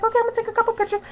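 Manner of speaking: high, quick put-on voice; imitating the star. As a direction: mimic her lightly, high and quick. Imitates the star taking pictures.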